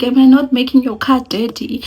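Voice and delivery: worried question to the driver. Retold or imitated. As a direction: ask sheepishly. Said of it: "I'm like".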